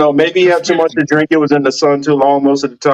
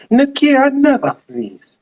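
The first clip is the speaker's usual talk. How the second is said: hammed-up African accent, higher and slow; mock African accent; 9 semitones higher than usual. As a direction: put on a playful African accent, drawn out. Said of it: "I don't wanna hear [name] do an accent".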